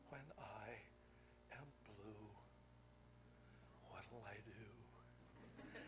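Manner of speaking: slow breathy whisper of the song line; whispered lyric. Retold or imitated. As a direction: whisper "what'll I do" slowly, drawn out. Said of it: "the song goes".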